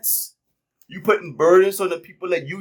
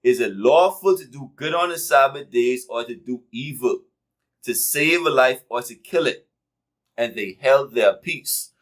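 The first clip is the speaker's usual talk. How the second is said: lower-pitched, measured reading aloud; scripture reading; 6 semitones lower than usual. Acted. Preacher reading God's question to the congregation, low scripture reading. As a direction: read out the verse with weighted pauses.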